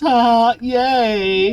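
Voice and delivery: mock cheer. Real, not acted. Lava everywhere, sarcastic glee.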